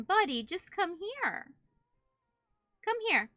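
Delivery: coaxing, high call to a dog. Real, not acted. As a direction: coax the dog to come over.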